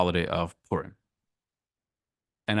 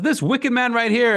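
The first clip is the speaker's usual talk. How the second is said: accusing; 13 semitones higher, 4 dB louder than usual. Acted. Esther accuses Haman before king.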